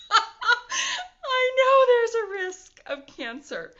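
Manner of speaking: real laughter through the words; laughing. Real, not acted. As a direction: laugh it out at the commenters. Laughing at expected comments.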